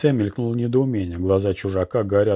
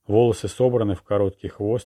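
usual speaking voice

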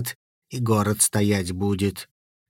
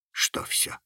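breathy scared whisper; 10 dB quieter than usual. A narrator's reading. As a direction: whisper it, spooked. Scared boy whispers question.

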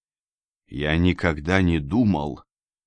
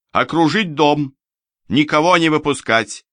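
high, firm, raised orders; commanding; 8 semitones higher, 4 dB louder than usual. A narrator's reading. Companion commanding the men filling the street, raised voice of command. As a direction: give orders to a whole squad. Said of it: "командовала моя спутница".

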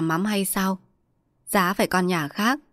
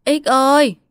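high, loud summons; calling out; 6 semitones higher, 7 dB louder than usual. A narrator's reading. Mistress summoning her tenant farmer, calling out loudly. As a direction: shout a name to someone farther off. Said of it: "rồi chợt bà nghĩ ra gọi".

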